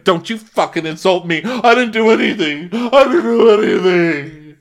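high, loud indignant yell; hammed-up outrage, voice climbing; mock yelling. Emily yelling at Gina, high and loud. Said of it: "Emily keeps yelling at Gina".

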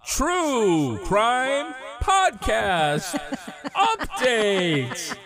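high, slow voice cracking up. Real, not acted. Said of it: "I love the cracking voice".

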